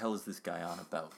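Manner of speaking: hushed irritated mutter; whispered aside. Acted. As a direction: say it under breath. Muttered aside about agent.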